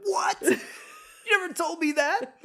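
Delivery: a son hearing he is a quarter Irish, mock-stunned; mock shock. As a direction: act out your own jaw-dropping surprise. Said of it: "I'm like".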